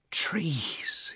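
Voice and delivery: breathy, awestruck whisper; hushed exclamation. A narrator's reading. Boy suddenly recognising what is growing, hushed exclamation. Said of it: "he exclaimed".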